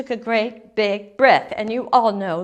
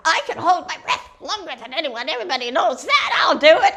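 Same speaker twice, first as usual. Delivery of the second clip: fast, higher-pitched eager duck; boastful merganser duck; 6 semitones higher, 4 dB louder, faster than usual. A narrator's reading. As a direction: brag quickly as an eager little duck. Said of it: "the merganser duck came forward right away and said".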